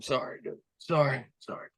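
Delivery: hushed, breathy whisper to a hurt opponent; whispered apology. Retold or imitated. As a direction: whisper a quick sorry under your breath. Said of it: "trying to whisper to him".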